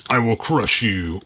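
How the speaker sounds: slow, lightly growled monster brute; Hulk voice. Retold or imitated. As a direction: play the Hulk, slow and heavy. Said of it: "I think the Hulk would crush him".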